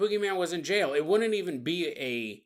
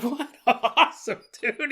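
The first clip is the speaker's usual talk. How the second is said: laughing, louder excited cheer; 6 semitones higher, 3 dB louder than usual. Retold or imitated. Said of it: "You're like".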